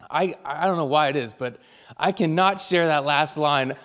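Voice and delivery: ordinary talk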